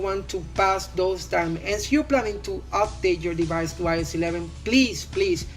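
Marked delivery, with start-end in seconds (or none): none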